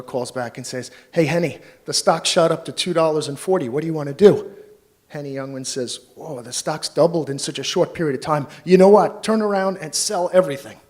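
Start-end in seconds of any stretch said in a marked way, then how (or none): 1.1-4.5 eager broker, higher and louder; hammed-up thrilled stockbroker on the phone; excited broker. Broker calls with rising stock. 6.2-10.8 higher and much louder, building to a near shout; hammed-up comedian yelling to dump his stock; excited sell order. Henny panics and sells everything.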